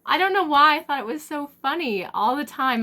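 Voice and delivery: laughing so hard it nearly turns to tears. Real, not acted. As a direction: crack up at your own joke. Laughing at her own joke.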